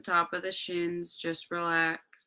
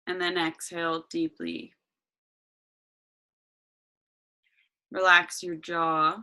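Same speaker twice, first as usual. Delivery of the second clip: guided meditation. Acted.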